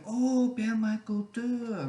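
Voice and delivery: high, slow, drawn-out French "Oh, permaculture"; mock French recognition. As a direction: ham up a Frenchman finally getting it. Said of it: "you have to say it with a French accent".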